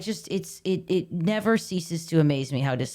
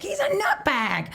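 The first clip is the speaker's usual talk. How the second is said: laughing; 11 semitones higher, slower than usual. Retold or imitated. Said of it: "he's like".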